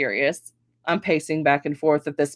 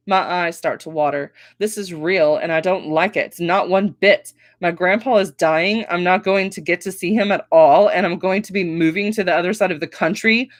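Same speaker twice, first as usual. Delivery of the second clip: ordinary delivery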